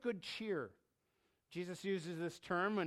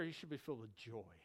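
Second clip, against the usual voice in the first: ordinary talk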